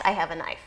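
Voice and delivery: "I have a knife" said quietly, falling, stress on "I". Shown as a demonstration. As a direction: lecturer demos intonation for the same text, low falling. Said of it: "that was poor intonation".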